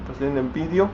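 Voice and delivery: ordinary delivery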